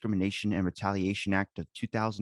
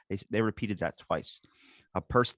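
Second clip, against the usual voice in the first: ordinary talk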